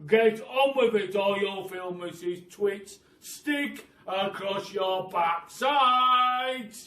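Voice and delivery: loud, slow barked command; fearsome monkey trainer. Acted. Mister Twit barks at the caged monkeys, fearsome trainer voice. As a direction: bark it like a drill sergeant. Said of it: "in a really fearsome monkey trainer's kind of voice".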